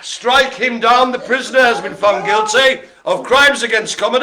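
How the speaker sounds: loud, high pronouncement of sentence; mock judge. Host sentencing someone for a bad joke, mock-solemn declaiming. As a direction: declaim the verdict like a hammed-up judge.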